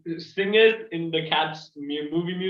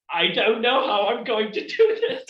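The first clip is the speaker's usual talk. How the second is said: raised, laughing mock bewilderment; imitating baffled composer; 5 semitones higher, 4 dB louder than usual. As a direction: play a composer at a loss, half laughing. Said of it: "looking at this idea and going".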